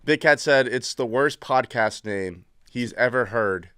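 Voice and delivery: usual speaking voice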